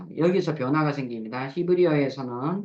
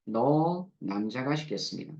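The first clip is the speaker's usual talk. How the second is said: ordinary delivery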